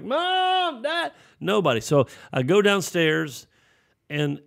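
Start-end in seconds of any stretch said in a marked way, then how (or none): 0.0-1.1 very high, drawn-out mock panicked call; frantic yelling. Boy finding the house empty, frantic high-pitched calling. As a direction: wail for your parents in acted panic. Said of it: "Then it more like".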